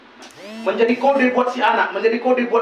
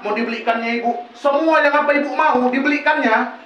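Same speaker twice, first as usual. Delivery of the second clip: normal talking voice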